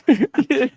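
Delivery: high, loud laugh; burst of laughter. Real, not acted. Laughs at the prank.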